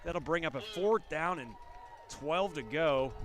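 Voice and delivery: loud, drawn-out live call; excited play-by-play. Real, not acted. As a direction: call the sack with rising excitement.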